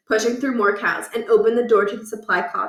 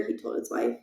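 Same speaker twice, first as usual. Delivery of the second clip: ordinary delivery, nothing marked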